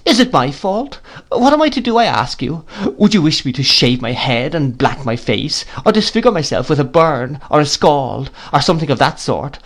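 tearful reproach. A narrator's reading. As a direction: scold through tears. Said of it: "shedding more tears".

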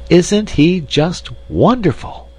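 high, drawn-out gushing admiration; enthusiastic. A narrator's reading. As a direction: gush with delight, swooping high. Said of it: "asked Miss Chim with enthusiasm".

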